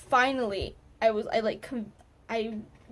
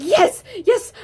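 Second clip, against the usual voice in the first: loud, high yell of triumph; reenacted victory yell, much higher and louder; replayed joyful scream; 9 semitones higher, 11 dB louder than usual. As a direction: shout it like you finally won. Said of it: "I was, like, screaming".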